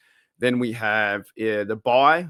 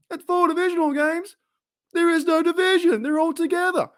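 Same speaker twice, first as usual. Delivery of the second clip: very high-pitched, incredulous sitcom-comedian voice; Seinfeld-style comic whine. Acted. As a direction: squeal the complaint in a strained, hammed-up comic falsetto. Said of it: "trying to do my Jerry Seinfeld impression".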